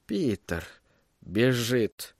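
soft, falling voice; hushed in-character murmur; hushed realization. A narrator's reading. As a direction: say it quietly, as if to yourself. Villain murmurs his realization to himself.

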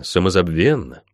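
ordinary delivery

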